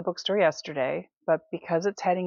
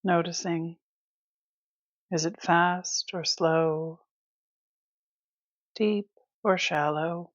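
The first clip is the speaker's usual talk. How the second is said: guided meditation. Acted. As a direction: ease them through it with long, restful pauses. Leads breath awareness.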